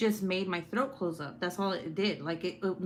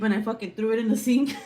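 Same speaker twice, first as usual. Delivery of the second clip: loud laughing retelling; 3 semitones higher, 6 dB louder, faster than usual. Real, not acted. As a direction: laugh it out loudly. Laughing while telling it.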